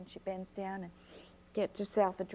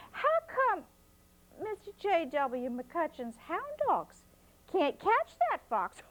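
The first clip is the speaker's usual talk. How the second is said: put-on high, bright-eyed child asking; curious little girl, voiced high; 8 semitones higher, 3 dB louder than usual. A narrator's reading. Child Flossie asks grandma.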